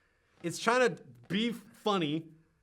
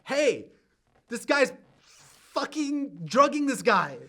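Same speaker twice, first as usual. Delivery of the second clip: mocking narration; 7 semitones higher, 11 dB louder than usual. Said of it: "It's so like".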